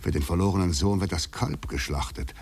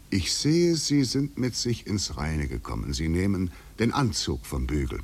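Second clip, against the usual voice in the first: usual speaking voice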